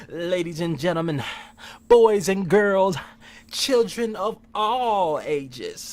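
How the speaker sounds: slow, booming ringmaster announcement; drawn out like a pompous showman; pompous mayor. Acted. Grand opening of the podcast.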